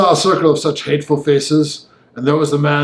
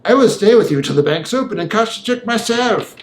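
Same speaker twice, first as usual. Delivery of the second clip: much higher, cold sneering gentleman; sneering villain; 7 semitones higher than usual. A narrator's reading. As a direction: sneer it out with cool menace. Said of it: "quite easy in sneering".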